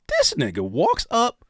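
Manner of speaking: usual speaking voice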